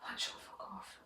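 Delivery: urgent hushed whisper, no voice. Retold or imitated. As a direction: hiss it under your breath so nobody hears. Urgent secret escape in class.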